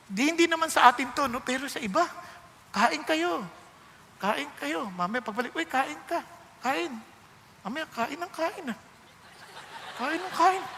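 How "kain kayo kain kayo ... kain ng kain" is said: softer, grudging "Kain kayo", a laugh on the last "Kain ng kain"; grudging host. Retold or imitated. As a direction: say "Kain kayo" as a forced invite that sours. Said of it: "Nag-iba na yung tono".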